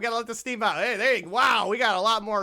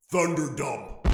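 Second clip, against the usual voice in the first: lower, booming game-show echo of the title; announcer echo; 6 semitones lower, much slower than usual. Acted. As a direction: call it out like a show's sting. Game title echoed as jingle.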